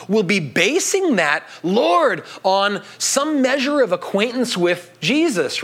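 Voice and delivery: ordinary talk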